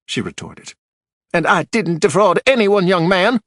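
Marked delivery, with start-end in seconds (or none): 1.3-3.4 louder, higher indignant widow. A narrator's reading. Widow denying fraud to Archer, louder and indignant. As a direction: bristle at the young man's charge.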